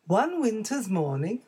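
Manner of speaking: ordinary delivery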